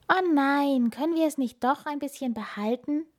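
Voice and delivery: high-pitched, disappointed plea; storybook begging, voice lifted; pleading. A narrator's reading. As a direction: plead like a reluctant little hare. Hare pleads to keep book.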